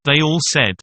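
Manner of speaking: ordinary delivery, nothing marked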